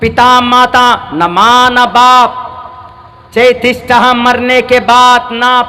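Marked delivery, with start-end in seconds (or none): none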